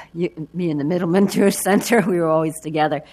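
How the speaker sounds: laughing through the words. Real, not acted. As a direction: chuckle as you say it. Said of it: "that was comic relief".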